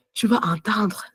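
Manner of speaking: playful whisper to her husband. Retold or imitated. Wife teasing her husband, whispered. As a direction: breathe the words out with no voice. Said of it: "je vais chuchoter".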